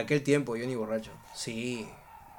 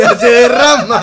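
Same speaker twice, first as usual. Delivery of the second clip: fuerte, reventando de risa; entre carcajadas; 3 semitones higher, 13 dB louder than usual. Real, not acted. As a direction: suelta el remate riéndote a gritos. Remata el chiste riendo. (hablando muerto de risa)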